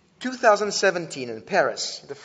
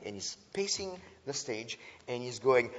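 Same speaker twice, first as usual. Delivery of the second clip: normal talking voice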